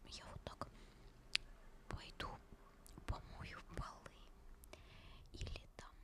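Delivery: ordinary delivery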